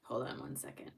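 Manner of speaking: murmured aside away from the viewers; quiet aside. Real, not acted. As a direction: drop to a whisper off to the side. Off-mic aside while handling refund.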